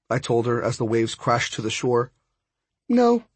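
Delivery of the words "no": high-pitched, abrupt no; firm refusal. Acted. Friend told she must learn to swim, refusing. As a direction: refuse outright, pitched well up.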